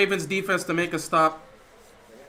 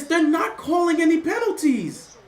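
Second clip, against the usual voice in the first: higher and slow, exasperated at the refs; frustrated disbelief; 6 semitones higher, slower than usual. Real, not acted. Streamer fuming that refs call no penalties, exasperated. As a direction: complain up at the ceiling, drawn out.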